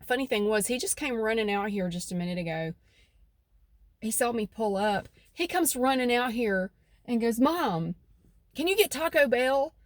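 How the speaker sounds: usual speaking voice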